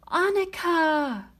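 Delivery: put-on high, drawn-out cry for her baby; desperate cry. Mother cries out as the cradle sweeps off, desperate high call. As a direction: call out as the cradle is swept away. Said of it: "cried Lena".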